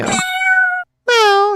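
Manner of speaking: long, very high meow like a stray cat; a drawn-out squeaky meow. Acted. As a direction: call out like an alley cat. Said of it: "it's an alley cat".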